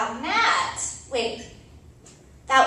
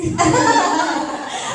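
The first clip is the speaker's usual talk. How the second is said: mocking laugh; 6 semitones higher, 7 dB louder than usual. Laughing at own insult.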